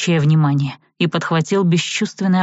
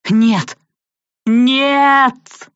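high, loud desperate scream; wail; 8 semitones higher, 5 dB louder than usual. A narrator's reading. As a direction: cry out as she falls. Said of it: "С воплем".

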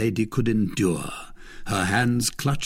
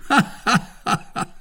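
high, jeering cackle of laughter; gloating laugh at the prisoner; mocking laughter; 11 semitones higher than usual. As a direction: laugh in her face. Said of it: "cried Lord de Winter".

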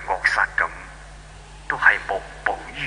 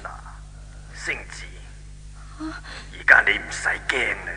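slow soft whispered comfort; gentle reassurance; 5 dB quieter than usual. Acted. God calming frightened Xingzi, slow soft whisper. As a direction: soothe a frightened girl.

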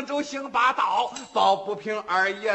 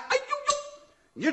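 a high, slow, stretched-out aiyoyo; yelp of pain; much slower than usual. Acted. Wu Song struck on the thigh by the club, high drawn-out yelp. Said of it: "武松腾的一声喊".

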